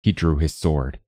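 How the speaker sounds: plain reading voice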